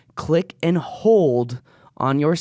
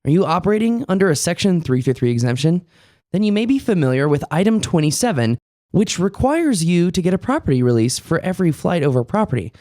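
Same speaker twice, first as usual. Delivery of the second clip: upbeat, louder sponsor pitch; ad read; 4 semitones higher, 4 dB louder than usual. Acted. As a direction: sell it like a commercial.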